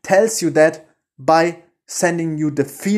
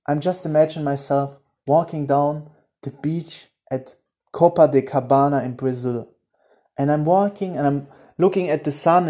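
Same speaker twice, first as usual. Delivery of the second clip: hushed guided daydream; guided visualization; 3 semitones lower than usual. Acted.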